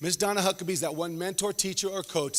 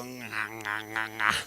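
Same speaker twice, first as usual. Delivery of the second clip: low, quiet mock chomping sound; chewing noise; 7 semitones lower, 6 dB quieter, slower than usual. Mimicking Kevin chewing his big sandwich. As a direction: mimic someone chewing with mouth full.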